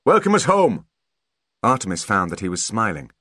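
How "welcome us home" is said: high, loud hail; father's shout. A narrator's reading. Father shouting to his son on arriving home, loud hail. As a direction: call out a big homecoming greeting. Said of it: "shouted his father".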